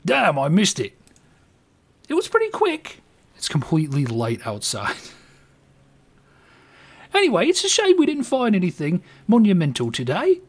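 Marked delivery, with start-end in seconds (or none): 0.0-3.0 higher, light mock British voice; disappointed Brit in a hammed-up accent. Winston missing the shooting star, British accent. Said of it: "the accent is just gonna change". 7.1-10.4 much higher British voice, a bit louder; wistful mock Englishman; British accent. Winston lamenting an empty day, British accent. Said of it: "the accent is just gonna change".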